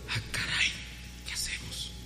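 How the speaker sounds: hushed, breathless fret; worried whisper. Retold or imitated. Cooks whispering about the missing wine, hushed. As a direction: whisper it in dismay.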